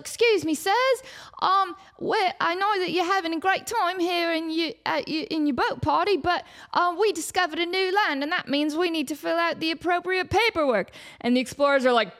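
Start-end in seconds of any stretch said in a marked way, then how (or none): 0.0-10.9 higher, timid little intern voice; an unpaid intern politely begging for paperwork; nervous intern. Acted. Intern addresses the explorers.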